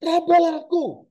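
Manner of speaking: high, loud, slow, fed-up touch me; Jesus urging doubting disciples, exasperated. Acted. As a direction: spell it out, fed up. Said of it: "Akan ada nada geregetan".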